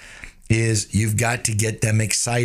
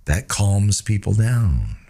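slow, low and calming; slow right down to settle them; 5 semitones lower, slower than usual. Shown as a demonstration. Salesman showing the calming voice, slow and low. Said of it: "talk a little bit slower and use the lower tones".

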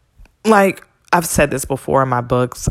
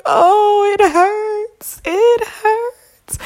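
high, loud, hammed-up cry of hurt; drawn-out, comically wounded whimper; mock tearful wail; 8 dB louder, much slower than usual. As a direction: wail it like an exaggerated sob. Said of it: "I'm tearing up and shedding tears".

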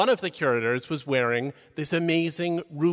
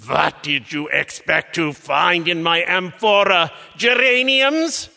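loud, drawn-out indignant outburst, soaring on the last word; outraged shouting; 2 semitones higher, 7 dB louder, slower than usual. Retold or imitated. Museum curator scolding two women knocking on his vase, outraged shouting. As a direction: thunder it slowly, word by word, in theatrical outrage.

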